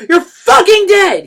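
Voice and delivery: a captured dealer spitting rage; spat. A narrator's reading. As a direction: spit the threat out. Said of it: "he spat".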